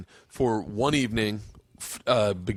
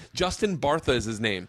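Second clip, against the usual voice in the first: normal talking voice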